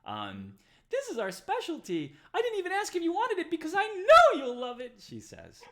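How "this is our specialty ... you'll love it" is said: very high, loud, squeaky hostess voice; squeaky, loud Darnsky serving the party; eager barmaid. Acted. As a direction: push the drink on them with bubbly certainty. GM voicing Darnsky the orc waitress.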